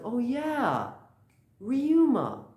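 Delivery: high, excited mock cheer; acted cheering. Bartender celebrating the one who saved them, excited cheer. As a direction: cheer the hero's name, pitched right up. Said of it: "everyone starts cheering".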